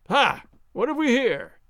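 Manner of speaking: startled gasp. Acted. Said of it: "Gonzalez gasped".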